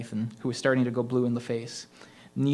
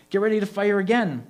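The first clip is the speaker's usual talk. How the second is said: higher, louder tense command; urgent order; 9 semitones higher, 6 dB louder than usual. A narrator's reading. Rhonda ordering the kids to reload, tense. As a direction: brace the team, nervous.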